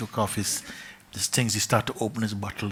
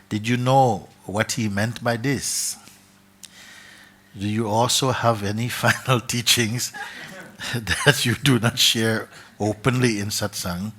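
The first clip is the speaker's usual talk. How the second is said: slow, louder reading aloud; letter reading; 2 semitones higher, 7 dB louder than usual. Acted. Reading a student's letter aloud to the gathering, clear. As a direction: read the question out clearly.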